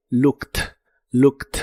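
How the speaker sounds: "Looked" ending in a crisp T, like "lookt". Shown as a demonstration. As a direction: end "Looked" on a sharp T sound. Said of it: "se pronuncia como si fuera una T".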